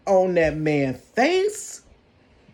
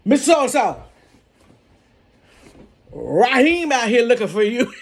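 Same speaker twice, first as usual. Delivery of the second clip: raised, higher call across the office; 4 semitones higher, 4 dB louder than usual. Retold or imitated. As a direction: call her name across the room. Coworker calls out for her.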